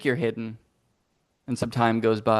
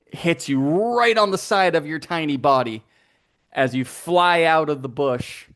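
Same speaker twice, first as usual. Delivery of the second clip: ordinary talk, nothing marked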